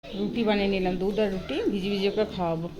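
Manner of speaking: ordinary talk, nothing marked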